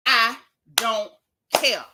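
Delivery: punchy, spaced I don't ... care with a clap; Juan Dixon's I don't care. Retold or imitated. Quoting Juan Dixon to viewers, punchy and spaced. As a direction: mimic the famous line, beat between words. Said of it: "Let me say it like Juan Dixon told Robin".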